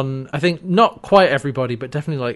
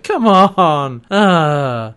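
hammed-up begging; 6 dB louder, much slower than usual. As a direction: beg as if on your knees. [name] on knees, begging.

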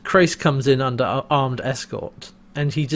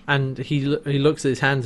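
ordinary talk

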